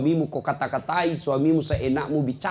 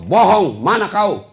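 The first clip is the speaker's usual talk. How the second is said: loud sharp "Bohong"; a wife shouting accusations down the phone at her husband; accusing wife; 5 semitones higher, 8 dB louder, slower than usual. Acted. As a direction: snap it out loud like a jealous wife.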